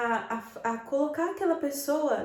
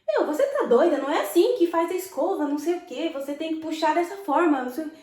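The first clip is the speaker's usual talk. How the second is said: higher, louder rude reprimand; rude scolding coworker; 6 semitones higher, 6 dB louder, faster than usual. Retold or imitated. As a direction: snap at a coworker over her work. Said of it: "Se eu viro pra essa minha colega e falo". (repreensão grosseira e alta à colega)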